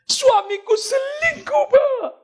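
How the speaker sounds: very high, drawn-out hammed-up cry; mock wailing wife. Betrayed wife phones her pastor about the affair, mock wail. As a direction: shriek it slowly, imitating a betrayed wife.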